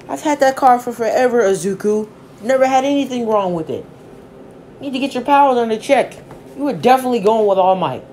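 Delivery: upset scolding mom. Acted. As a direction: tear into him over the car. Mom scolding over broken car.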